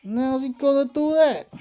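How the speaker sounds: put-on startled young hero voice. Voicing Yugi, startled.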